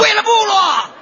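high shouted war cry; gamer battle cry. Acted. Son-in-law gaming, shouting the Horde battle cry. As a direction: yell it like a game rallying call.

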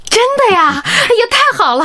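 high delighted squeal of good news. Acted. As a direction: burst out with joy.

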